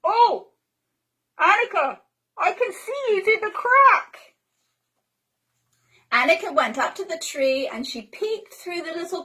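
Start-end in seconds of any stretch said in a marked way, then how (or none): 0.0-4.3 put-on high, louder playful child voice; Pippi calling. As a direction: call out gleefully. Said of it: "Pippi said".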